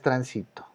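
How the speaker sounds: transito stressed on SI; stress on middle syllable. Shown as a demonstration. Teacher introduces transito as a grave, middle syllable stressed. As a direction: put the weight mid-word in transito. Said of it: "Es grave".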